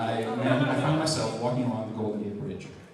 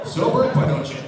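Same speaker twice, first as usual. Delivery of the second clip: mock scolding; 9 semitones higher, 8 dB louder than usual. Principal scolding in retold story.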